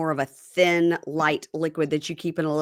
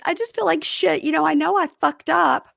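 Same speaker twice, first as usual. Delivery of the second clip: wife; 10 semitones higher than usual. Retold or imitated. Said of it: "So she goes".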